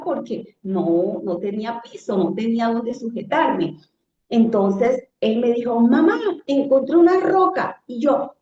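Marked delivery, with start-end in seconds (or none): 5.8-7.8 put-on higher, louder child's call; excited call. Son calling to his mother in the flood, excited. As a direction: shout the discovery up high.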